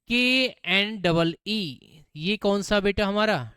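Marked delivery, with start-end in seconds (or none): 0.1-1.8 letters spelled one by one slowly; slow spelling. Shown as a demonstration. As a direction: dictate each letter slowly. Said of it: "उससे next मैंने आपसे बोल दिया". (अक्षर धीरे धीरे बोलना)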